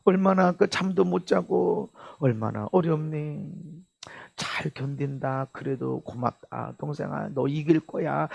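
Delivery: soft, low, tender words to a hurting brother; hushed, loving reassurance; tender love. Shown as a demonstration. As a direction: gently comfort him, nearly whispering. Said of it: "그러고 해줘야 돼".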